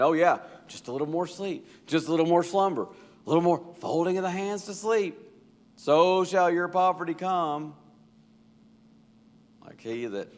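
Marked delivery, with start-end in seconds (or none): 0.0-7.7 higher and louder sarcastic taunt; mock the lazy man's excuse back at him; mockingly. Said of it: "kinda mockingly saying".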